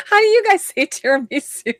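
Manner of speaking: very high, giggly squeak of amusement; laughing question. Real, not acted. Host asks friends how they say tiramisu, laughing. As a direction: ask it through helpless laughter.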